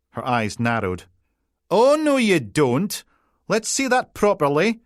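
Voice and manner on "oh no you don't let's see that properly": suspicious Peterhead PC. Acted. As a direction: drag it out, not having it, in Peterhead Scots. Suspicious PC checking card.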